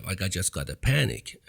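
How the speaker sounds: ordinary delivery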